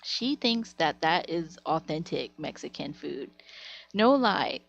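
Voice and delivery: ordinary talk, nothing marked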